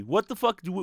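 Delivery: ordinary talk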